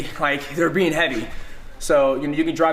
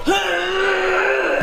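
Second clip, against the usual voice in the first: wysoki, głośny jęk wysiłku; udawany okrzyk przy rzucie; high effort grunt. Shown as a demonstration. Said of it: "They go".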